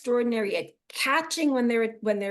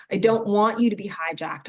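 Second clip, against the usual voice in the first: normal talking voice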